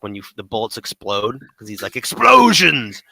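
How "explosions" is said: big, high, drawn-out yell; screamed impression. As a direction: scream it like an over-the-top game character. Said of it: "he screams at the top of his lungs".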